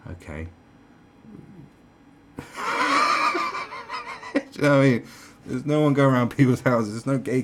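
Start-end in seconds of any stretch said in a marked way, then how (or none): 2.4-4.3 high wheezy laugh; long breathy giggle. Real, not acted. Comedian amused at his own point, laughing.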